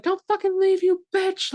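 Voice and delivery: shrieking high and loud, mock scream. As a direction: screech it like the girl trapped in the well. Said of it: "the girl's like".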